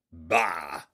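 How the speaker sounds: low dismissive snort. A narrator's reading. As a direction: scoff at him with contempt. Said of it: "snorted Hefstag".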